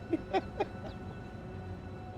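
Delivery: short, airy laugh up high; high giggle. Real, not acted. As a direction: break into a high, breathless giggle. Laughs at the pilot skill check.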